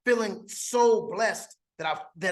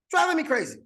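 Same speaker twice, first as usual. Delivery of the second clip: playful high echo; 8 semitones higher, faster than usual. Acted. Recruit teasing his own wordplay, higher. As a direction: jump way up and sing out driving me crazy.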